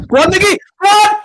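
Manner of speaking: screaming with joy at a goal; goal celebration shout. Real, not acted. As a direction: yell it out, overjoyed.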